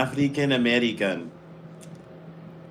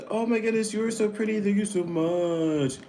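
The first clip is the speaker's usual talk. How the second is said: gushing; 9 semitones higher, faster than usual. Acted. Gushing at a subscriber.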